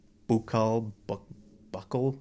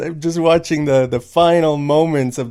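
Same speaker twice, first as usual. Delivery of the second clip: excited; 3 semitones higher, 9 dB louder, slower than usual. Real, not acted. Excited vlog opener.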